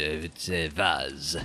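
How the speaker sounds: a clumsy attempt at a French vase; poor French accent. Shown as a demonstration. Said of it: "very poor French accent".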